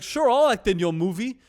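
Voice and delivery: loud, high Italian restaurant owner; Italian accent. Retold or imitated. Italian restaurant owner agrees to join the film, in a loud Italian accent. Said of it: "the most Italian dude I have ever met".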